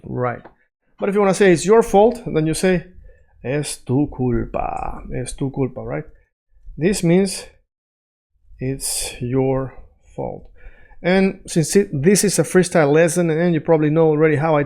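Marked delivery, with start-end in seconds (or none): 3.4-5.0 slow, soft, word by word; stretched-out teaching pace; slow demo. Shown as a demonstration. Models new phrase slowly. 8.6-10.5 very slow, softer, word by word; slow demo. Shown as a demonstration. Translates slowly word by word.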